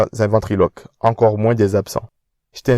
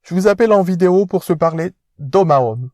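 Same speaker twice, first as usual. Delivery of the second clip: pompous rich fiancé, pitched up; posh fiancé; 9 semitones higher than usual. Acted. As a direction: speak down to him like an aristocrat.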